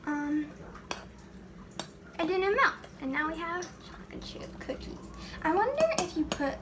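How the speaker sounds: ordinary talk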